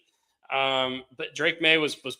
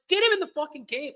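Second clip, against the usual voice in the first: retold angry yelling; 11 semitones higher than usual. Retelling someone yelling on the sideline, high and exasperated. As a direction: imitate someone yelling, pissed off. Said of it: "like, yelling".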